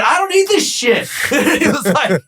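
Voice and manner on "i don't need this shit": very high-pitched retold outburst, laughing; emphatic repeated refusal. Broker repeating himself to tenant in parking lot, defiant. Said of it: "What'd you say?".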